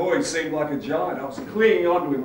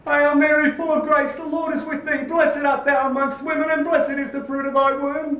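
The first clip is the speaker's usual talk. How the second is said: loud, high frantic prayer; urgent Hail Mary on a high note; desperate praying; 8 semitones higher, 4 dB louder than usual. Acted. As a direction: pray in terror, pitched up high. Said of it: "I started praying".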